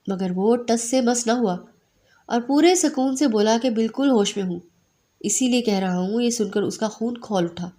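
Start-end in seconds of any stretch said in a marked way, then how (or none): none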